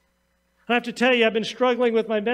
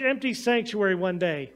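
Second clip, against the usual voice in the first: ordinary talk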